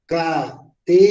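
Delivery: Catedral slowly split as ca, te, dral; ca... te... dral, spaced out; syllable by syllable. Shown as a demonstration. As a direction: break Catedral into three separate beats. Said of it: "Fuerza de entonación".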